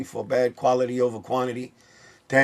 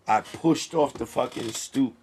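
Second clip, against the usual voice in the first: ordinary talk, nothing marked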